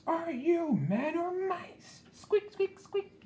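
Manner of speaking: high, slow, jeering yell then squeaks; mocking soldiers yell. A narrator's reading. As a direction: taunt like cowards mocking mice. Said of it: "yelled the soldiers".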